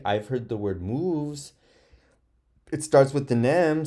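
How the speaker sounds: usual speaking voice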